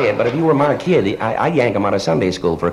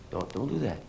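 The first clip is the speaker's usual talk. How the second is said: hushed; 3 semitones lower, 14 dB quieter than usual. Acted. Backs off, child starts crying.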